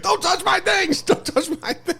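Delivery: very high mock shriek; imitated screamer. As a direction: screech slowly as the ranting woman. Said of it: "She's like".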